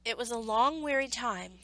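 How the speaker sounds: ordinary delivery, nothing marked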